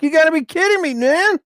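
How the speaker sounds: high-pitched mock disbelief. Retelling his reaction to leaves piled in his yard, high incredulous whine. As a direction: squeak it out in exaggerated disbelief. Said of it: "was like".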